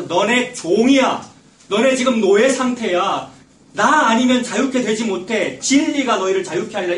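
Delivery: loud, slow, weighty declaration; stern rebuke. Acted. Jesus telling the proud believers they are slaves, stern and loud.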